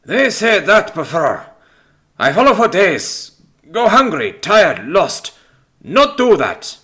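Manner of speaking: put-on loud, high, accented outburst; exasperated, heavily accented complaint; angry protest. A narrator's reading. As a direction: fire off grievances in a Greek accent. Haunted man rebuffs supposed ghost.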